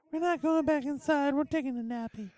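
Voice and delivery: very high, small mock childlike voice; sleepy little cassettes. Voicing cassettes that fell asleep, tiny high mock voice.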